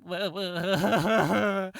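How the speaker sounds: hammed-up little wah wah cry; mock wailing. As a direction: whimper it like a sulking creature. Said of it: "he's like".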